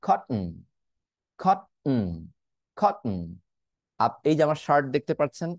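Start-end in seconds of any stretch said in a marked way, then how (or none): 0.1-3.2 "কটন" with T swallowed, then hummed n; "কটন" as kot, tiny break, n; glottal stop T. Shown as a demonstration. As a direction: catch the breath in "কটন", finish on a nasal hum. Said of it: "এটাকে আমি বলতে পারি".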